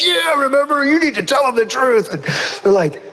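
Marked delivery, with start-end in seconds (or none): 0.0-2.1 high, eager mock demand; imitated insistent, high-pitched plea; vindicated brother. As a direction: act out a sibling urging a confession. Brother demands the truth, acted.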